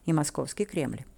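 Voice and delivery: plain reading voice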